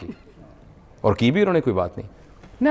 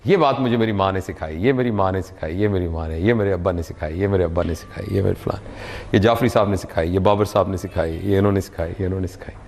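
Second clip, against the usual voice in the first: reflective and low; 8 semitones lower than usual. Real, not acted. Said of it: "if I close my eyes".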